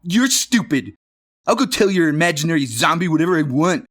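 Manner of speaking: high, loud, fast sneer. Acted. Billy sneers at Johnny and pushes past, high and fast. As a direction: spit it back at him. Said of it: "Billy said with a sneer".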